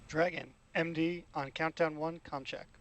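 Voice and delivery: tinny radio transmission; astronaut radio. Real, not acted. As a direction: voice through a headset channel. Crew com check over radio.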